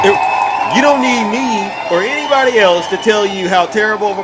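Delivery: exasperated shout at viewers; heated shout. Real, not acted. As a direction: yell it at the chat. Ranting about Trump.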